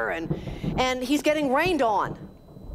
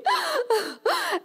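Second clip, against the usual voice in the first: laugh through tears. Retold or imitated. End of desperate prayer.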